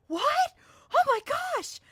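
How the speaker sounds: retold astonished squeal; shocked gasp. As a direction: play their jaw-drop surprise. Producers shocked she's Southern.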